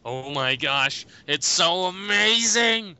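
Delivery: mock excitement. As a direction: gush like an overexcited fan, stretching it out. Mock fan gushing over new theme.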